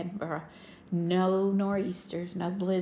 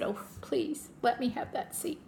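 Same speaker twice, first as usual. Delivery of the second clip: higher, breathy whispered begging; retold whispered plea; pleading; 5 semitones higher, 3 dB quieter than usual. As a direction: beg softly for the seat, pitch raised. Begging the agent for the seat.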